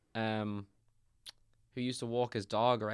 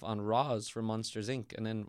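ordinary talk, nothing marked

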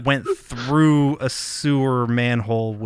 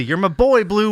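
quoting the line; 8 semitones higher, 6 dB louder than usual. Retold or imitated.

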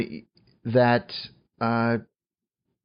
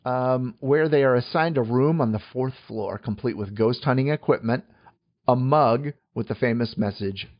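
ordinary talk

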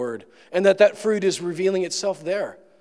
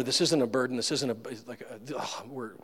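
ordinary talk

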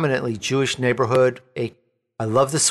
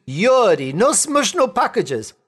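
higher, broken mock Russian accent; 7 semitones higher, slower than usual. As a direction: ham up a Russian bandleader's broken English. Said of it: "no, excuse me".

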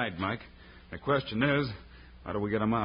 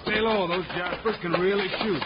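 urgent shout; 7 semitones higher than usual. Acted. Shouting under gunfire.

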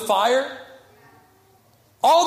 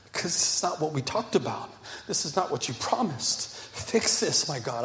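tearful prayer; 4 semitones lower, 4 dB quieter than usual. Retold or imitated. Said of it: "going to God once in tears".

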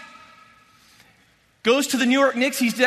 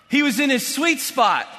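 ordinary talk, nothing marked

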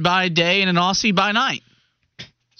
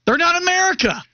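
mock patriotic declaration; 9 semitones higher than usual. Cohost defending patriotic take.

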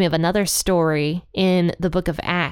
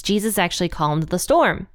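ordinary talk, nothing marked

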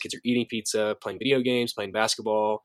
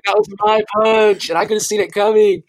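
loud, high, cracked up with laughter; laughing exclamation; 9 semitones higher, 10 dB louder than usual. Real, not acted. Son telling of dad's punch meant for him, loud and laughing.

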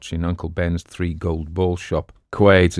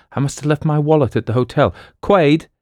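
flustered call; 9 semitones higher, 4 dB louder than usual. Acted. Boston calls Quaid, no money.

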